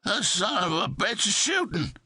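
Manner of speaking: high, frantic yell from cover; flustered FBI man yelling from the freezer; panicked yell. A narrator's reading. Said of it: "He yelled from inside".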